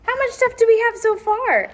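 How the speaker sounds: high, bright question; cheerful mom. Acted. Mom checking the pile, high and eager.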